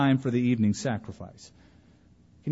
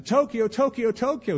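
boy's mock prayer; 8 semitones higher than usual. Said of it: "every now and then".

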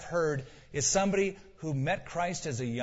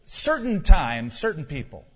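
"certain times" much louder and slowed; punched "certain times"; 9 dB louder than usual. Real, not acted. Preacher scorning part-time obedience, "certain times" hit hard. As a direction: hammer "certain" sarcastically. Sarcastic emphasis on selective obedience.

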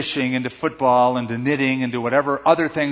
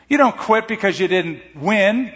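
ordinary talk, nothing marked

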